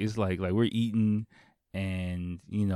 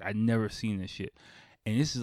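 ordinary talk, nothing marked

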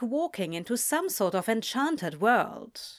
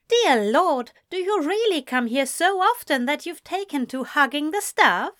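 higher, mock-exasperated question; teasing; 7 semitones higher, 4 dB louder than usual. A narrator's reading. Ezra to his sister about hugging, teasing. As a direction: rib a sister with exaggerated disbelief. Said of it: "he teased".

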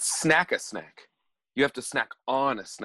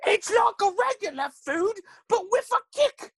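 very high, slow squeaky pitch; squeaky goofy voice. Acted. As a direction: sell it like a silly chef. Gary pitching food again.

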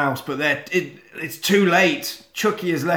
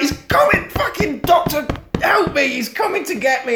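mock terrified child; 12 semitones higher than usual. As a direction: squeal like a scared kid begging. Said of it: "he's like".

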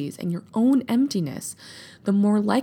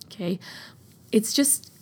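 ordinary talk

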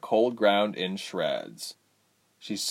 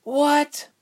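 very high incredulous "what"; disbelief; 5 dB louder than usual. A narrator's reading. Ilse learns of a betrayal, high-pitched disbelief. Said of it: "said in disbelief".

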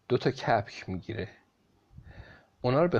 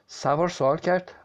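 usual speaking voice

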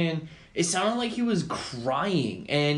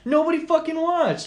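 high-pitched, exasperated repeat; indignant complaint; 13 semitones higher, 4 dB louder than usual. Real, not acted. Host repeating his livestream gripe to co-host, exasperated. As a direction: complain it like a wounded performer.

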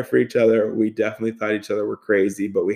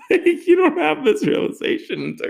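laughing. Real, not acted. Laughing at own realization.

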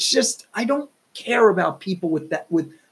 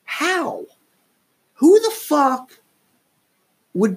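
incredulous; 8 semitones higher, much slower than usual. Retold or imitated. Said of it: "And I was like".

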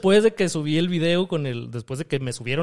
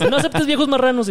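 laughing; 10 semitones higher than usual. Retold or imitated. Quoting his own advice, laughing.

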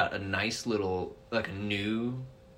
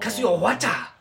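high mock Japanese gibberish; fake Japanese; 11 semitones higher, 6 dB louder than usual. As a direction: fake foreign words, pitched up. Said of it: "pretending to speak Japanese".

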